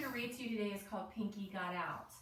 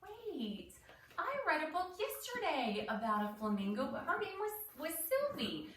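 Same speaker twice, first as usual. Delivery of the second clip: gasp, then higher and excited; sudden realisation; 7 semitones higher than usual. Shown as a demonstration. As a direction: act out noticing the link, pitch jumping up. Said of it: "This is when I say".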